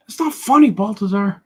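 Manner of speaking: high, loud scolding character voice; heckling critic. Acted. Critic scolds the artist for laughing, high heckling voice. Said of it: "hope that critic leaves me alone".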